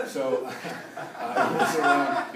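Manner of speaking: high, loud, laughing words; still laughing. Real, not acted. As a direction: laugh your way back into the story. Still laughing after the joke.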